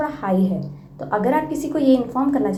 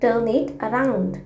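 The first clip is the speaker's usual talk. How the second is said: slow model phrase; much slower than usual. Shown as a demonstration. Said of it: "तो आप कहिए".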